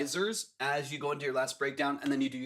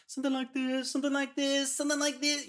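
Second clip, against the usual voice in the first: sung much higher, squeaky chipmunk style; 11 semitones higher than usual. Shown as a demonstration. Producer shows the vocal rising to chipmunk pitch, much higher. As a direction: squeeze the hook up into a chipmunk voice. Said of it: "chipmunk voice".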